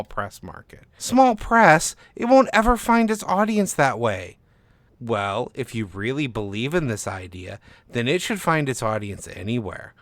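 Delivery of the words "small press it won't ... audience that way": much higher, louder outraged voice; indignant disbelief. Acted. Author protesting to the agent, much higher and louder. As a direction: scoff in disbelief.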